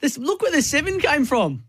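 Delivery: very high, amazed exclamation; excited race reaction. Real, not acted. Punter points out where the seven came from, high and excited. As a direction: marvel at it, pitched right up.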